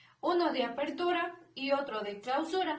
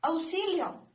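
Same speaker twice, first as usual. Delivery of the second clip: higher, mock cry for help; exclaimed example; 5 semitones higher than usual. Shown as a demonstration. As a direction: call out auxilio like a light plea. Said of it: "como podría ser unos claros ejemplos".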